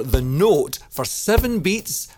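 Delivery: ordinary talk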